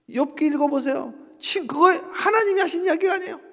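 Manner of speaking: ordinary talk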